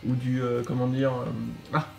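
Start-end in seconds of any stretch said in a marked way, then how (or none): none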